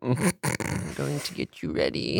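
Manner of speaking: banshee voice. Pretend. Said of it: "pretty good banshee voice".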